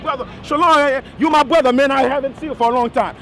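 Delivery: normal talking voice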